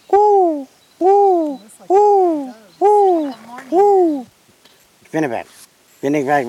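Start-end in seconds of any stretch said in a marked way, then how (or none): 0.1-4.3 high, evenly repeated whoops; crested pigeon call. Retold or imitated. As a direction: hoot like a pigeon, one long note at a time. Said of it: "and they go".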